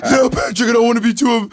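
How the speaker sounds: high, put-on cartoon voice; mock cartoon impression; Patrick. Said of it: "Patrick's like".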